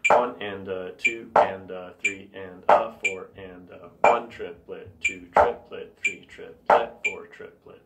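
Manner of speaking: even, rhythmic syllables in threes; counting the triplets. Shown as a demonstration. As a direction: count triplets strictly in time with the sticks. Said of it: "playing the accents from the triplets".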